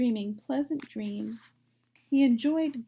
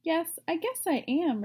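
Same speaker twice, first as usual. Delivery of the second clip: higher, lightly voiced pig; young pig voice; 6 semitones higher than usual. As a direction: answer like a proud young pig. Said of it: "replied Wilbur".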